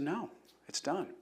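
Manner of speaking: barely holding back tears; choked up. Real, not acted. Telling the mother her son's ordeal is over, choked up and soft. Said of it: "sometimes I get choked up".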